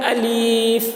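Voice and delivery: الف stretched too long; sung, over-stretched الف; wrong drawn-out alif. Shown as a demonstration. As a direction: drag out الف too much. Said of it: "جو کھینچ کر پڑھتے ہیں یہ بھی غلط ہے".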